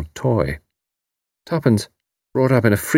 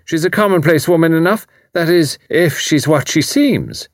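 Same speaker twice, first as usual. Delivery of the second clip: Irish landlady; 8 semitones higher than usual. A narrator's reading. Mrs O'Rourke voiced.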